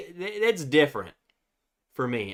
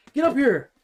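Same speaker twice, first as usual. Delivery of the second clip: much higher and louder pet voice; high coaxing call; 10 semitones higher, 5 dB louder than usual. Real, not acted. Owner calling dog up for the viewers, high excited coaxing. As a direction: call her up excitedly like a puppy.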